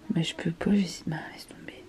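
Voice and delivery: soft unvoiced whisper. Real, not acted. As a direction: whisper it under your breath. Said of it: "whispered aside". (chuchoté)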